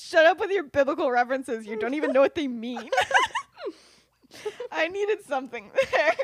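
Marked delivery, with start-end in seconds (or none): 0.0-2.9 loud, high retort breaking toward laughter; mock angry retort. [name] dismissing James's olive branch remark, mock angry. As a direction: snap back in hammed-up annoyance.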